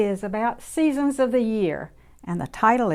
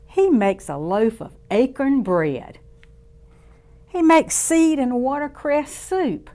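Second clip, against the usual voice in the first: lively storybook voice for little ones; reading to children; 4 dB louder than usual. A narrator's reading. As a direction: read it warmly to a circle of kids. Reads picture book to children.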